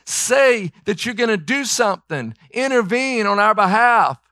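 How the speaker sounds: high, pressing cry to Jesus; panicked disciples begging Jesus; desperate pleading. Retold or imitated. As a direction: beg for rescue as the boat sinks. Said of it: "They were saying".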